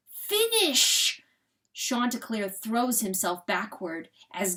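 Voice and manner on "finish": high strained cry; pained scream. A narrator's reading. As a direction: cry out in pain to end it.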